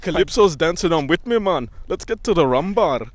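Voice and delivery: hammy Caribbean parody; mock Caribbean accent. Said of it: "every Caribbean stereotype".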